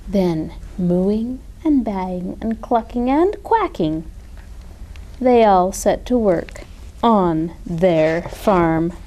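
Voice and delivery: ordinary delivery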